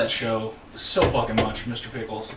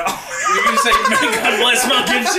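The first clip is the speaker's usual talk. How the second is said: incredulous laughing; 7 dB louder than usual. Real, not acted. Mocking a misheard blessing.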